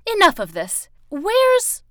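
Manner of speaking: loud demand shooting up high; high, loud, broken-off demand; impatient outburst. Acted. Villain loses patience, breaks off.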